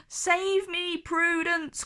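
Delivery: high, desperate plea; crying out. A narrator's reading. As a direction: cry for rescue. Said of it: "cried Norman".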